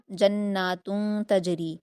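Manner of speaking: جناتن with the doubled noon nasalised and held two counts; ghunnah on noon. Shown as a demonstration. Teacher models the nasal ghunnah in جناتن. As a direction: hum the noon in جناتن through the nose. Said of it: "جناتن میں آپ نون مشدد میں غنہ کریں گے".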